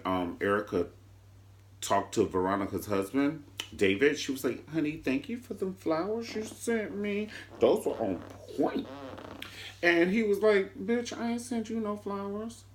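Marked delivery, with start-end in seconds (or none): 4.7-8.9 higher, soft sugary put-on voice; sweet-talking the husband; mock sweet Erica. Erica sweetly thanking David for the flowers, mock innocent. 10.8-12.6 higher, soft sassy put-on voice; mock husband retort. David telling Erica he sent no flowers, sassy denial.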